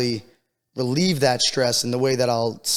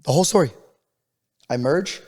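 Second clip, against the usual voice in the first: ordinary talk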